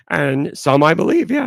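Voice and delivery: ordinary talk, nothing marked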